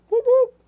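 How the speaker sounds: high, loud whoop whoop; high-pitched party cheer; celebratory whoop. Real, not acted. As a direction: whoop it up for your own sign. Cheering for his own star sign.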